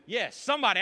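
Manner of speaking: ordinary talk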